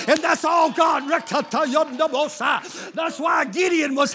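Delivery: loud, high, shouted sermon climax; full-force preaching. Real, not acted. Preacher shouting to the congregation in full force.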